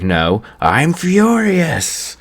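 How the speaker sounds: slow, very high put-on voice; a hammed-up high furious Beerus voice; furious god. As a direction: stretch it out in a high, mock-menacing voice. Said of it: "And he's like".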